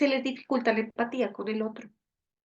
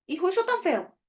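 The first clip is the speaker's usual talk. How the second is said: higher-pitched mock parental disapproval; disapproving parent; 6 semitones higher than usual. Critical parent answering the child's gift, mock disapproval. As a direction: react like a fault-finding parent.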